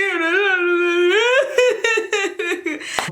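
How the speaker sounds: breaks into a real laughing fit; can't hold back the laughter, half crying. Real, not acted. Laughs at her own crying story.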